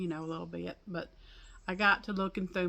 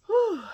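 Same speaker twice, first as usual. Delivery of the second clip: high relieved whew; 9 dB louder than usual. Real, not acted. After struggling up from under porch.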